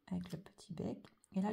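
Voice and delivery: normal talking voice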